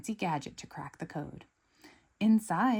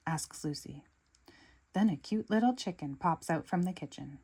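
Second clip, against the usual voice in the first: ordinary delivery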